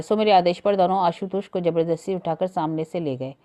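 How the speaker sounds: ordinary delivery, nothing marked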